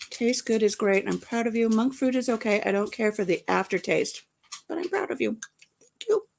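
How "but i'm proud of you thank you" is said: high, sweet praise voice; high cheery voice. Retold or imitated. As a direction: read it like a proud friend. Reading chat comments in voice.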